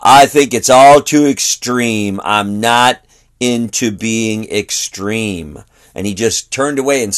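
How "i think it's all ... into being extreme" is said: slow, drawn-out retold disapproval; deliberate, dragging mock objection; sour disapproval. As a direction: imitate a sour man refusing, word by word. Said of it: "he was giving sour snare looks".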